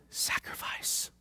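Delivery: a slow breathy whisper; hushed sermon whisper. Acted. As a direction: whisper it like a secret. Whispered key word.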